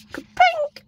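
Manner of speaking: high squeal of delight at the pink; excited squeal. Real, not acted. Unboxing stationery, thrilled by the pink, squealing. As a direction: squeal it like you just saw your favourite colour.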